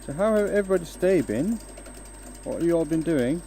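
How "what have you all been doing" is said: usual speaking voice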